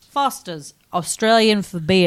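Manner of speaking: slow mock-Aussie slogan; Australian ad accent. Shown as a demonstration. Co-host's turn in the game, slow Australian accent. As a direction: drawl it like an Australian beer advert.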